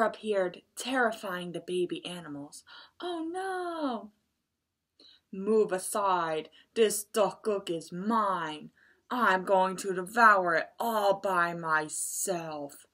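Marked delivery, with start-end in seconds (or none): 3.0-4.1 high squeaky cry of alarm; small creatures startled by the tiger; frightened little animals. Acted. 5.3-12.9 slow, growly villain voice; greedy tiger. Acted.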